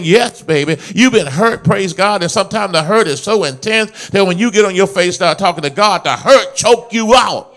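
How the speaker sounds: loud, rolling sermon cadence; impassioned preacher in full flow; full-force preaching. Real, not acted.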